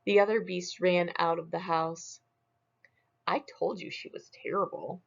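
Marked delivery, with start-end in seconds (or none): 3.3-5.0 softer, fast "I told you she was terrible"; quick quiet told-you-so. Acted. Claus quietly reminds Smug he warned him.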